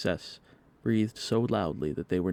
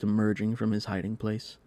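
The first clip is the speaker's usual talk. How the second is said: ordinary delivery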